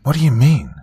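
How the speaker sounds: high-pitched anxious question. A narrator's reading. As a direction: ask with voice jumping up. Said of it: "I asked".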